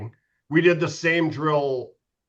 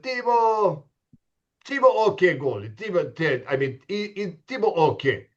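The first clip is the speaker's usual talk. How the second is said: put-on Russian-accented coach calling names, higher; Russian accent, lightly hammed; 3 semitones higher than usual. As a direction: mimic the Russian coach working out the roster. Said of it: "That was my Russian accent pretty good?".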